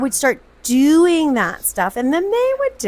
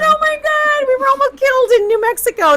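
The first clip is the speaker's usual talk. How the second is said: high-pitched comic alarm, a bit louder; mock panic; 14 semitones higher, 3 dB louder than usual. Wife retelling a near-disaster in New Mexico, mock panic. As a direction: squeal it in exaggerated dread.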